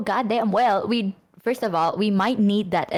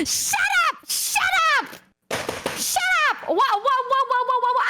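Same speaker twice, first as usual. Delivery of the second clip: very high, squeezed yelling; flustered outburst; 14 semitones higher than usual. Acted. Cutting off the friend's romantic details, high flustered shout. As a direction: squeal it out to cut them off, flustered.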